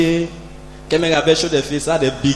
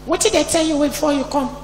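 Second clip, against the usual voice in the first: put-on small boy; 8 semitones higher, 8 dB quieter than usual. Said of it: "one small boy just tap my leg".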